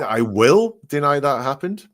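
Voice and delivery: "will" pushed up high and loud; stress on will. Shown as a demonstration. Host shows co-host the future tense, stress on "will". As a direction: punch "will" with a rising, questioning lift. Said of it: "So it's in the future".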